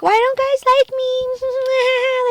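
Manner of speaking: high, very slow whiny voice; mock whiny self. Mocking her old insecure self to viewers, high and whiny. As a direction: drag it out like a pitiful teen.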